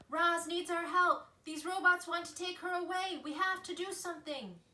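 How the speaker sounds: rally the forest to help; animals calling out. A narrator's reading. Animals call out together.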